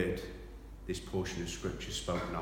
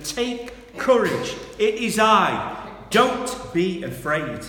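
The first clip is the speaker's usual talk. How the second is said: commanding call; 11 semitones higher, 12 dB louder, slower than usual. Acted. Jesus calls out over storm.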